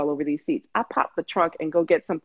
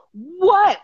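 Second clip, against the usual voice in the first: high, louder startled What; alarmed what; 12 semitones higher, 6 dB louder than usual. Retold or imitated. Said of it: "she was like".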